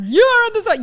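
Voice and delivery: very high, loud imitation of an alarm warning; mock surveillance voice. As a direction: blare it like a security system, breaking off. Mimicking the security system's warning.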